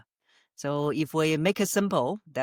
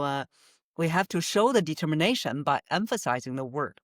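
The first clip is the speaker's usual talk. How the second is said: ordinary delivery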